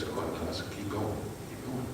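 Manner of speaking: very soft, unhurried reassurance; barely voiced, steady encouragement; calm quiet urging. Jesus tells the nervous disciples to carry on, calm and hushed. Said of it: "And Jesus just says".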